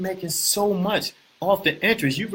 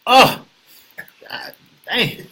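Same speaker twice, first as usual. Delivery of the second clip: strong drink reaction; 9 semitones higher, 7 dB louder, much slower than usual. Real, not acted.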